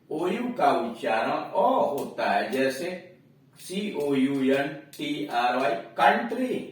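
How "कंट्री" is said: 'कंट्री' with a short a; pronunciation demo. Shown as a demonstration. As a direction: say 'कंट्री' crisply after spelling. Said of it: "अब यहां देखिए". ('कंट्री' का उच्चारण)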